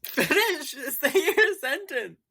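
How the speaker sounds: high, slow, giggling plea; laughing. Real, not acted. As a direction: beg through laughter. Laughing, urging partner on.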